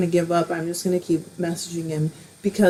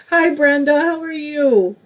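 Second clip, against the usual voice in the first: higher, louder hello with a wavering, tearful edge; teary greeting; 7 semitones higher, 4 dB louder than usual. Real, not acted. Greeting a viewer while trying not to cry, teary and wavering. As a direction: greet her brightly while holding back tears. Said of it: "I don't wanna cry".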